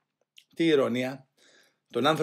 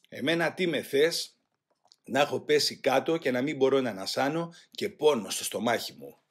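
ordinary delivery, nothing marked

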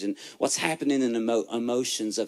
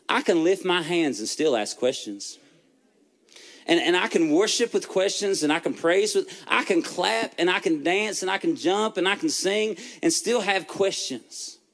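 rising preacher's fervour, higher and louder; preaching; 7 semitones higher, 3 dB louder than usual. Acted. Pastor preaching to the room with growing fervour. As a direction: lift the room like a pulpit climax.

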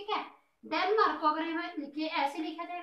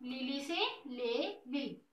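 slow sound-alike demo; 2 semitones lower, 4 dB quieter, much slower than usual. Shown as a demonstration. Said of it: "Italy से कौन सी tone आ रही है?".

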